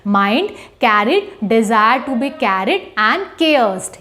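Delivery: very slow, spelling out each word; slow dictation. Shown as a demonstration. Teacher dictates the rubric to students, very slow. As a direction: read it out slowly so students can write.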